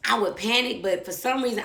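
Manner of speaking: ordinary talk, nothing marked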